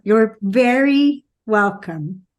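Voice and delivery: ordinary delivery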